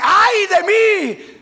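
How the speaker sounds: slow, high, loud wail of despair; anguished cry. Acted. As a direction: wail it out in terror before God. Isaías clama ante Dios. (grito lento de angustia, agudo y fuerte)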